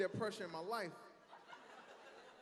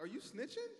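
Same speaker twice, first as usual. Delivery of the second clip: higher, disbelieving question; incredulous question; 7 semitones higher, 4 dB quieter than usual. Retold or imitated. To the snitching passenger, higher and disbelieving. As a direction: ask it half stunned. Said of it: "I was like".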